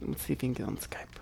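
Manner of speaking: fast hushed whisper. Real, not acted. Host whispers to cohosts before the Skype call. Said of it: "Why are you talking like this?".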